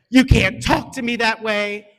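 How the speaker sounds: loud, high mock scream; retold scream. Aunt screaming back at the child, loud mock scream. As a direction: shout it back at a five-year-old. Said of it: "screamed back".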